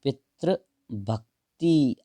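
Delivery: पितृभक्ति drawn out very slowly, part by part; spelling demonstration. Shown as a demonstration. Teacher showing how it is written, slow syllable-by-syllable demonstration. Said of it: "देखो कैसे लिखी जाती है".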